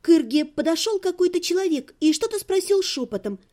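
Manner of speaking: ordinary delivery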